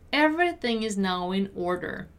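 carefully spaced teaching read; measured teacher's model. Shown as a demonstration. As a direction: say each word deliberately. Teacher models sentence slowly first.

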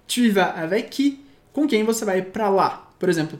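normal talking voice

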